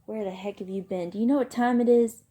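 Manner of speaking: put-on furious older brother confronting him; angry. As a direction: scold him, building to a loud question. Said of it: "He was madder than I'd seen him".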